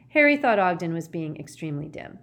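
ordinary delivery, nothing marked

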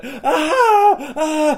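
high, loud yells of combat; re-enacted battle scream. Player re-enacting screaming while fighting the guard, loud mock screams. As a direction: bursts of wild screaming, played for laughs. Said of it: "I'm just screaming like".

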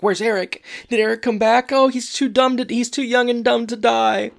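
high-pitched mock panic; hammed-up anxious wail; nervous panic. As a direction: imitate a frantic worrier, voice shooting way up. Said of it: "looking around nervously".

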